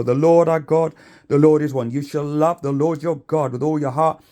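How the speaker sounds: loud, ringing sermon voice projected to a crowd; preaching at full force. Acted. Preacher calling out to a crowd about love, full force preaching. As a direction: proclaim it like a street preacher.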